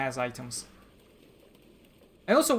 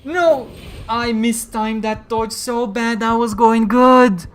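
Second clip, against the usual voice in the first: loud, slow frustrated yelling; raging gamer; 7 semitones higher, 14 dB louder, slower than usual. Real, not acted. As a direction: rage at your own mistake. Lost fight, raging at himself.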